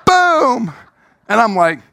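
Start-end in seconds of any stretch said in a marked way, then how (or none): 0.1-0.7 high loud laughing shout of you; pointing call. Retold or imitated. Director in the crowd singling him out, high and loud. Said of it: "It was bullseye".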